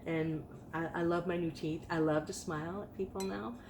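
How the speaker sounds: ordinary delivery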